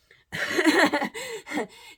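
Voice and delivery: loud, high burst of laughter; amused, high-pitched laughing fit; real laugh. Real, not acted. Amused at her own long meditation.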